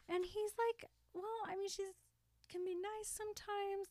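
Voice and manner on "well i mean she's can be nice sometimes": high and soft, unsure; hesitant hedge. Retold or imitated. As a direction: float the excuse up high and quiet. Erin hedging in retold scene.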